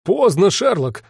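urgent, high desperate cry; desperate shout. A narrator's reading. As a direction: warn him it's too late. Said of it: "вдруг отчаянно крикнул Ватсон".

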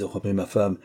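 plain reading voice

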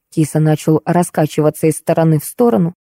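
normal talking voice

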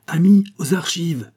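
high, loud call to a crowd; urgent shouted exhortation; rallying cry. A narrator's reading. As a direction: cry out to rally your friends. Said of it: "cria le docteur".

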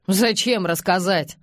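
plain reading voice

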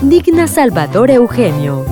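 ordinary talk, nothing marked

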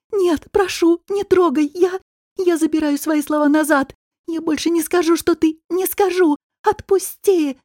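high, panicked begging; frightened pleading. Acted. Woman takes back her words before her attacker, pleading.

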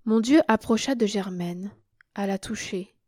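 ordinary delivery

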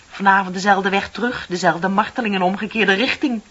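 building from grumble to exasperated outcry; rising bitter rant. Acted. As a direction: let the frustration boil over. Building rant about daily misery.

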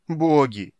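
sobbing cry. A narrator's reading. Merchant begs the gods, sobbing. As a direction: wail it out in despair. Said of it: "рыдал Кевинард Ван Влит".

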